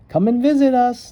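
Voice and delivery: high, loud call across distance; family shouting goodbye; shouted farewell. Acted. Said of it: "they shouted".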